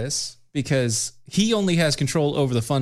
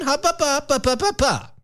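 high sing-song stop call; 1 sing-song hop up; 12 semitones higher than usual. Real, not acted. Interrupting her cohost with a high sing-song call. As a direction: chirp it high like halting a dog.

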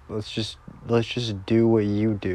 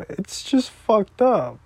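high, slow tearful outburst; drawn-out tearful complaint; real crying; 8 semitones higher, 4 dB louder, slower than usual. Real, not acted. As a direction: wail it out, upset. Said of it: "I'm not supposed to be crying".

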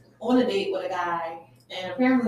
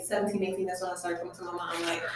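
ordinary delivery

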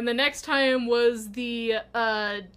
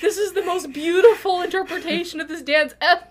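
laughing mock awe; 6 semitones higher, 7 dB louder than usual. Awed onlookers, laughing.

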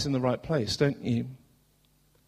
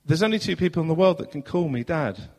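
normal talking voice

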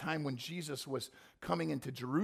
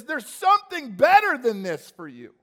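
fervent plea; 8 semitones higher, 14 dB louder than usual. Acted. Jesus speaking to those who hate him.

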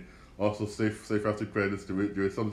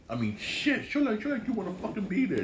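exasperated high plea; 12 semitones higher than usual. Real, not acted. Pleading with the losing team.

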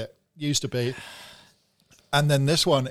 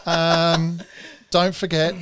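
ordinary delivery

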